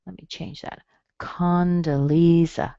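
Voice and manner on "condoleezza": "Condoleezza" slow, with LEE strongest; stress on lee. Shown as a demonstration. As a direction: lean on LEE and stretch it slowly. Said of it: "Lee is where your strong part is".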